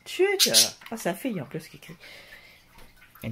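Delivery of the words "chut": very high-pitched shush. Real, not acted. Vlogger hushing the screamer after scolding, high shush. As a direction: shush them in a high squeal.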